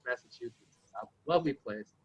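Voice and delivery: usual speaking voice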